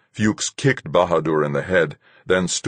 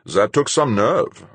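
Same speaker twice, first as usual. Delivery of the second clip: lightly voiced captain, slow and louder; captain's character voice; 7 semitones higher, 5 dB louder, slower than usual. A narrator's reading. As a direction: draw it out as the appraising captain.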